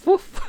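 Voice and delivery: very high, loud squeaky poof; laughing at the vanished body; mock poof. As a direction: pop it like a cartoon vanishing. Body vanishes, mock sound effect.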